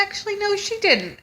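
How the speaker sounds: mock the nurse's breezy answer; mimicking school nurse. Mom imitating the school nurse breaking the news, high and breezy. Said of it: "And they said".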